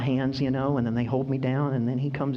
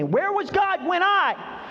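high, slow, pained cry to God; hurt believer crying out at God; anguished complaint; much slower than usual. Acted. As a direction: ask it like a wounded believer.

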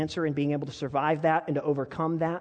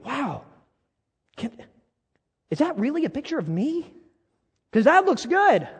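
amazed at photo; 10 semitones higher, 6 dB louder than usual. Retold or imitated. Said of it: "you go, after you see the picture".

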